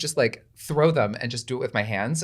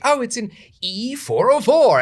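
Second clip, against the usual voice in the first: much higher, louder, slow theatrical exclaim; stage excitement; 13 semitones higher, 7 dB louder, slower than usual. Retold or imitated. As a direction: announce the seat like a big reveal. Acting the grail discovery on stage.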